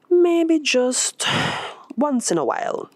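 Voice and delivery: a hint of wistful musing; inner thought. Maribel's thought voiced.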